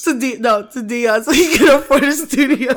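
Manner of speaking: loud, high call over the laughter; loud playful appeal. Real, not acted. Asking listeners to donate to a broke friend, loud playful appeal. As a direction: shout out a joking plea for money.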